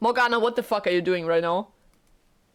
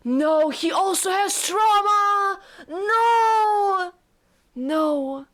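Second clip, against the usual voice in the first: high, loud, slow wailing no; crying protest; 12 semitones higher, 5 dB louder, much slower than usual. Real, not acted. Streamer wailing no as the heroine is hurt. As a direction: cry out in real distress. Streamer distraught at character's cruelty.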